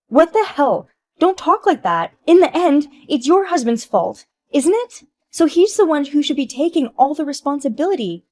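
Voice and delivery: high, loud shrill protest; indignant outburst. Acted. Wife shouting at her friend, blaming her husband. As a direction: shove blame onto the husband.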